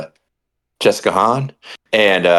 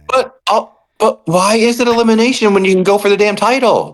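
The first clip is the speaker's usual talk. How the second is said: high, incredulous complaint; exasperated rant; 11 semitones higher than usual. Real, not acted. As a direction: vent at the bookers in disbelief. Ranting at bookers.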